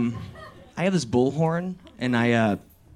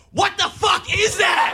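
Steve flipping out. Retold or imitated. Brother Steve hears the bullhorn and flips out. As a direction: yell in shock at a strange noise. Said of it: "Steve flips out like".

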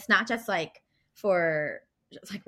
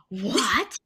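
mock indignant what; 5 dB louder than usual. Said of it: "And I'm like".

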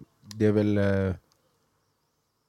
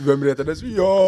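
mock replay; 8 semitones higher, 6 dB louder, slower than usual. S1 replays the scolding.

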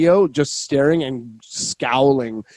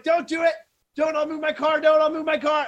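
very high mock yell, held back in volume; panicked pleading; 14 semitones higher, 4 dB quieter than usual. Driver running to save his car from a ticket, panicked. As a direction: beg from afar in a high, thin call.

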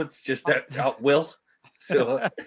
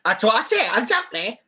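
character voice; 7 semitones higher, 3 dB louder than usual. Retold or imitated. Repeating the quoted character.

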